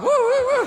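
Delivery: loud falsetto yelps; very high, poorly imitated pig; dog-like pig squeals. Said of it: "That pig sounds like a dog".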